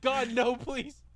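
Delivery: high, panicky begging; mock pleading. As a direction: plead like a creature being dragged off. Voices captured creatures begging.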